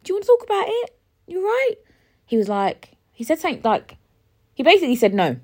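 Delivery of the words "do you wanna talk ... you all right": much higher, sing-song concern; sweet high concern. Retold or imitated. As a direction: coo it up high like a carer. Her sweet voice to him.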